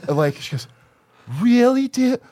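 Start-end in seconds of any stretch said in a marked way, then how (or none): 1.4-2.2 high, whiny "really, dude"; imitating a preachy nineteen-year-old; mock indignant kid. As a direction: mimic a snotty offended student. Mocking college kid's retort.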